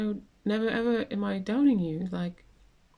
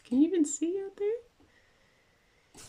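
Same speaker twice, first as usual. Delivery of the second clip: cat talk; 12 semitones higher, much slower than usual. Real, not acted. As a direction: coo it to the cat. Talking to her cat.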